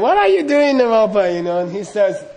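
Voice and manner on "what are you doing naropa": high, loud cackling hag voice; a taunting hag who is looming over the monk; cackling old hag. Retold or imitated. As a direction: cackle it at him like a witch. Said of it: "she is cackling at him".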